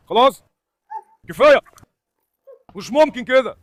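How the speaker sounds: loud fed-up commands at the dogs; loud exasperated shouts at dogs; shouting to stop. Real, not acted. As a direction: shout them apart. Breaking up dog fight.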